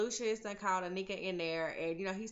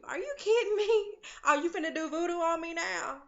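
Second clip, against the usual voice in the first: much higher and louder incredulous questions; outraged disbelief; 8 semitones higher, 5 dB louder than usual. Real, not acted. Picturing herself facing the voodoo mama, high outraged disbelief. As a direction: push back in shocked disbelief.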